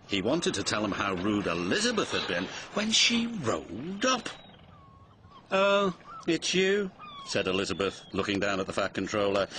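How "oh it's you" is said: high, slow, disdainful greeting; haughty lorry. A narrator's reading. Elizabeth looks down at the Fat Controller, haughty.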